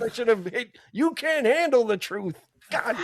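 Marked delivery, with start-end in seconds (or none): 0.9-2.3 higher, drawn-out mock film outburst; lightly hammed-up courtroom drama line; movie-line outburst. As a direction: stretch it out like a quoted movie line. Mimics famous film line.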